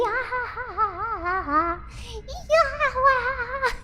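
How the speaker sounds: high, wailing laugh of excitement; overexcited high cackle; giddy squealing laugh. Real, not acted. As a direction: let out a long, giddy, almost crying laugh. Overcome by excitement.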